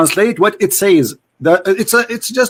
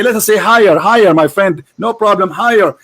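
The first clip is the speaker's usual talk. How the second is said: usual speaking voice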